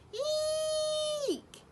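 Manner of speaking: shrill high owlet screech like a trodden cat; a piercing squeaky cry, pleased with itself. A narrator's reading. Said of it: "like the noise a cat makes".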